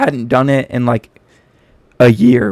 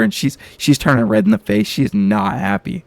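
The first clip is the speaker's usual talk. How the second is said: normal talking voice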